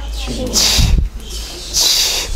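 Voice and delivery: voiceless ch, stop then friction; whispered ch demo; isolated ch sound. Shown as a demonstration. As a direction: push out a breathy ch twice.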